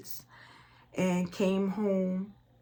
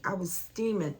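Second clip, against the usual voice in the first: ordinary delivery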